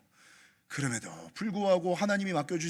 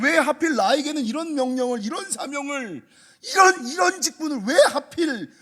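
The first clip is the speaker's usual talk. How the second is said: loud, higher cry of why me; anguished lament; 7 semitones higher, 10 dB louder than usual. Acted. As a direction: plead upward, voice straining. Jeremiah laments his calling.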